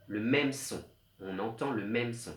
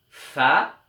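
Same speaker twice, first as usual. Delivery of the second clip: fa said louder; stressed fa; 2 semitones higher, 9 dB louder than usual. Shown as a demonstration. Teacher showing the rhyme, fa said loud. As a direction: hit the syllable fa. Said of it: "on entend la note".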